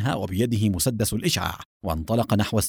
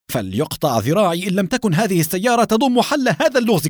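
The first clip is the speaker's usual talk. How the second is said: high, loud, emphatic vow; excited exclamation; 9 semitones higher, 5 dB louder than usual. A narrator's reading. Captain running to his car after spotting a clue, excited exclamation. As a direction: declare it urgently, pitched up.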